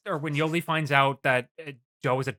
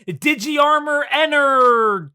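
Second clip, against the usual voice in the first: loud, high, slowly stretched battle cry; weird reading; 11 semitones higher, 8 dB louder, much slower than usual. Retold or imitated. Davis calling his armor evolution, weird drawn-out shout. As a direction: drag out the last word in an exaggerated yell. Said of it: "it's, like, a really weird reading".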